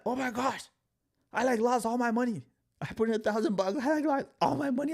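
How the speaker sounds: high, loud panicked wail, replayed; mock panic. Retelling to viewers how he lost everything, high panicked wail. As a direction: act out the younger self freaking out.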